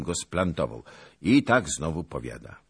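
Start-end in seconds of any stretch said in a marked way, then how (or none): none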